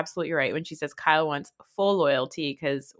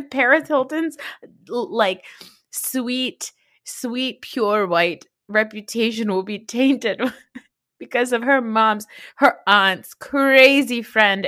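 sarcastic; 5 semitones higher, 4 dB louder, slower than usual. Real, not acted. Sarcastic rant about reputation.

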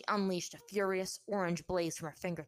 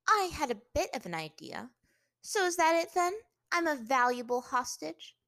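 higher, louder scoff; 9 semitones higher, 9 dB louder than usual. A narrator's reading. Captive princess to her captor, scoffing. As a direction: challenge him with scornful disbelief. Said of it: "she scoffed".

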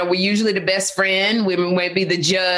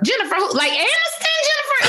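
much higher, squeaky surprised question; stunned disbelief. Retold or imitated. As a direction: gasp it out in disbelief. Shocked at famous friend.

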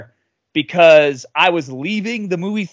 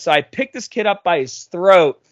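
plain reading voice

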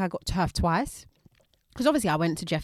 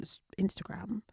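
whisper; 13 dB quieter than usual. Real, not acted. Plugging social handle.

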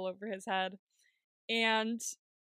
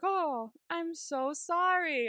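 higher, slow, sugary mock sorry; fake apology; 8 semitones higher, much slower than usual. The prisoner to Sun after ruining the painting, mock sorry. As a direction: lay the fake sorry on thick. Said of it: "And she's like".

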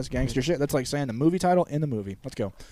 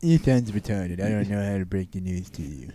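mock impaired doctor; 6 semitones lower, 4 dB quieter than usual.

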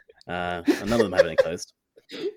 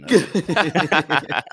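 laughing; 8 semitones higher, 5 dB louder than usual. Real, not acted. Embarrassed laugh about posh parents.

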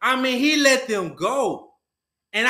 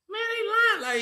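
inner voice; 13 semitones higher, slower than usual. Retold or imitated. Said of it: "in my mind saying".

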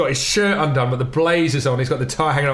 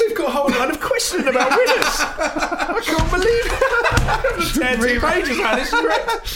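laughing in disbelief; words bubbling through real laughter; 13 semitones higher than usual. Real, not acted. Host laughing at the guest's surprise story.

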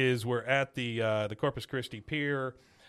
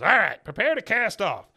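mock captain; 6 semitones higher, 6 dB louder than usual. Captain voice retold.

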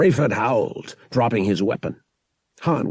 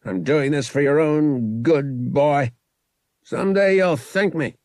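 put-on higher, louder, cold threat to a boy; menacing villain; 6 semitones higher, 4 dB louder than usual. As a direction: lean over him and promise pain.

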